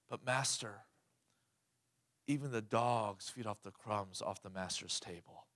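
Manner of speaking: low, soft humble plea; the woman humbly answering Jesus, low and soft; humble reply. Acted.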